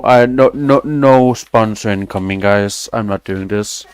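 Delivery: ordinary talk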